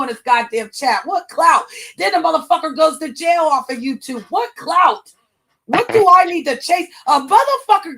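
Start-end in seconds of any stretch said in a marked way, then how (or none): 1.0-8.0 indignant yelling. Real, not acted. Mocking ex's clout claims.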